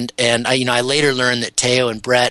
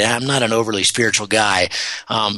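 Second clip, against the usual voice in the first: ordinary talk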